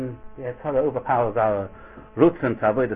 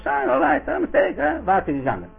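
dismissive shrug; 6 semitones higher, 4 dB louder than usual. Acted. A sinner shrugs off his mistake.

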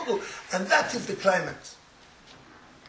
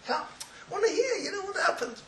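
eager asking; 13 semitones higher, 4 dB quieter than usual. Retold or imitated. Nudging him, eager to hear.

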